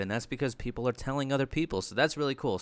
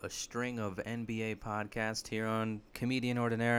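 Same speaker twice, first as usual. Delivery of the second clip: ordinary talk, nothing marked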